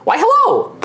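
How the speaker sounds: very high, bright-eyed shopkeeper voice; young gnome girl. Acted. Young gnome greeting customers at the desk, high and chirpy. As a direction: chirp it up like a little gnome clerk. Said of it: "she looks up and she's like".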